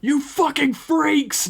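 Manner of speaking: high, drawn-out furious scream; full-throated enraged yell. A narrator's reading. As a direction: scream it at the captors, voice far up. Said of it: "Johnny screamed out".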